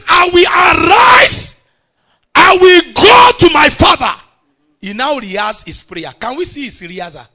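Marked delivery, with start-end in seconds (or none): 0.1-1.6 high, loud, ringing declaration; emphatic declaration. Shown as a demonstration. Preacher shows church how to say it, emphatic declaration. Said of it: "say it as if you have heard me very well". 2.4-4.1 loud, high-pitched proclamation; resolute declaration. Acted. Prodigal son resolving to go home, resolute declaration.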